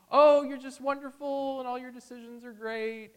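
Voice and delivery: high, loud gushing praise; fawning flattery. Acted. Flatterer buttering up a wicked person, gushing. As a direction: gush like a yes-man in a singsong high voice.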